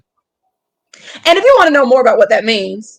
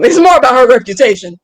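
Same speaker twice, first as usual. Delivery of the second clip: ordinary delivery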